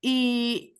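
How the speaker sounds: ordinary talk, nothing marked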